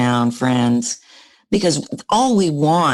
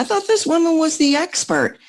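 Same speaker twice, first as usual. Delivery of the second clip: much higher, doubting voice; mocking high voice; 11 semitones higher than usual. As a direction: sound up high and dismissive. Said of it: "process that as".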